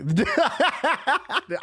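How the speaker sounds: high-pitched burst of laughter. Real, not acted. Streamer cracking up over his wish list, high laugh. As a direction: laugh high up, well above talking pitch. Mocking laugh at not caring.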